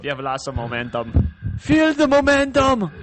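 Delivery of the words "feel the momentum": high, slow, loud hammed-up proclamation; mock dramatic. Joking to friends about momentum, high, slow and loud. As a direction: draw it out like a grand announcer.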